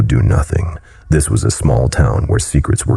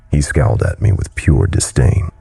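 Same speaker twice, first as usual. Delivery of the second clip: plain reading voice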